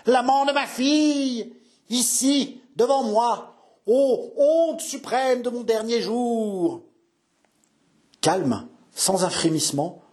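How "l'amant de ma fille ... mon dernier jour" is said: high, slow, drawn-out outrage; aghast old father. A narrator's reading. Old father seeing his daughter's lover before him, aghast outcry. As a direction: wail the shame as an old nobleman. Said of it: "reculait en bégayant".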